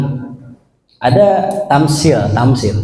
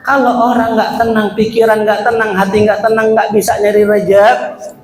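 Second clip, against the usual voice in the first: higher, insistent sermon lines; preaching emphasis; 7 semitones higher than usual. Acted. Preacher warning listeners, higher and insistent. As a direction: drive the point home.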